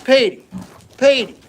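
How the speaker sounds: high, loud calls of the name; calling an animal. Real, not acted. Keeper calls Petey back to the tomato, calling high.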